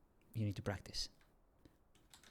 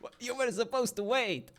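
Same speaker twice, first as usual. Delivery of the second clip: surprised yelp; 5 dB louder than usual. Real, not acted. Run over by the horse early.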